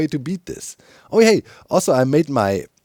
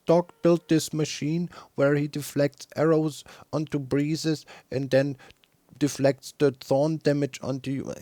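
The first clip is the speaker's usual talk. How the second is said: robot voice; 2 semitones higher than usual. Retold or imitated. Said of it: "the robot reading out".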